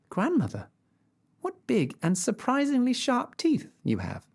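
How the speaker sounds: put-on high, bright little girl answer. A narrator's reading. Girl answering the wolf, high and eager. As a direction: chirp it like a small girl.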